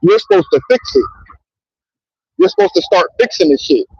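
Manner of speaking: ordinary talk, nothing marked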